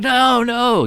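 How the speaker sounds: high, drawn-out comic "no, no"; high-pitched mock protest; sitcom dismay. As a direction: mimic a sitcom character's dismay. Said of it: "like a scene out of Seinfeld".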